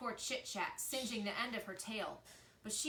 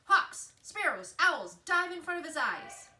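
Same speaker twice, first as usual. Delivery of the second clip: hooting owl orders; 8 semitones higher, 10 dB louder than usual. A narrator's reading. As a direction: order the birds from the sky. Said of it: "Swooper hooted orders from above".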